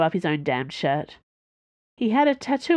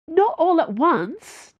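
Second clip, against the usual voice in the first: higher, slow, exasperated; impatient exasperated reply; impatiently; 11 semitones higher, 4 dB louder, slower than usual. A narrator's reading. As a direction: spell it out as if to slow children. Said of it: "Dana said impatiently".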